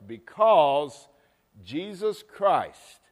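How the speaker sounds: slow and loud, stretching Jesus Christ; emphatic preaching. Acted. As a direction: lean hard on the name. Slowed emphatic proclamation.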